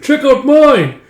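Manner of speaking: high, loud boast; proud kid's call; excited showing off. Acted. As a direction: show it off eagerly. Youth showing off his gear.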